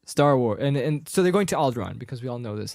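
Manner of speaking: ordinary talk, nothing marked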